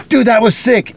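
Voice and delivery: high, hyped-up praise; stoked. Retold or imitated. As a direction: gush like an amped fan. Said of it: "I come up to you all stoked and go".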